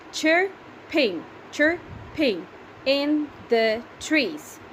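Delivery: chirping in the trees, read slowly syllable by syllable. Shown as a demonstration. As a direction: count out each syllable slowly for the class. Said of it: "Can you count how many are they?".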